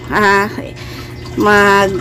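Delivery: ordinary talk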